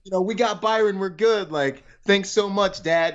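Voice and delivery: high, breezy voice of his mom; a cheery mom passing the burden on. Retold or imitated. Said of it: "I mean, my, my mom".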